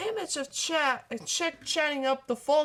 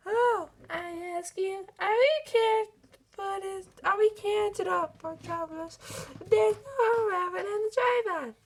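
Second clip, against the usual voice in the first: pleading lament; 8 semitones higher than usual. Acted. Pleading speech to the court.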